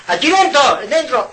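high, loud mock order; a touch of commanding cleric. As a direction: lightly imitate the church ordering them in. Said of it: "Y han dicho a los hombres".

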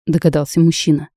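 ordinary delivery

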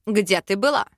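mildly indignant, put-on character voice. A narrator's reading. Arinel confronts heroine leaving the portal room, indignant. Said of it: "Возмущался Аринель".

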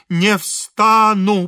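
loud, high, very slow refusal; stubborn refusal. A narrator's reading. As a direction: stretch out each syllable stubbornly. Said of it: "повторил отец Фёдор".

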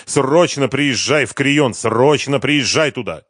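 insistent and forceful; urgent shout. A narrator's reading. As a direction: shout urgent instructions down the phone. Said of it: "закричал Дронго".

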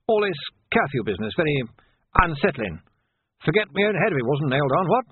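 put-on fussing old captain making excuses; flustered old captain. Old captain excusing his forgetfulness to the sergeant, flustered.

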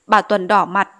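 plain reading voice

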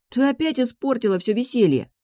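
put-on high, whiny child's complaint; aggrieved little-brother voice; whining. A narrator's reading. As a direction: whine like a spoilsport got you. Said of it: "законючил младший".

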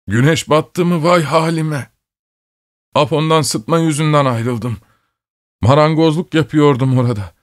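high, tearful pleading; plaintive, high-pitched lament; wailing sobs. A narrator's reading. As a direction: plead as if weeping, pitch climbing into a wail. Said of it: "ulumaya benzer hıçkırıklar yükseldi".

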